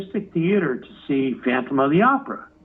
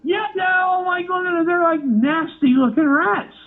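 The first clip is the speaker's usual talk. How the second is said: disgusted outburst; 11 semitones higher than usual. Real, not acted. Recalling disgust at rats.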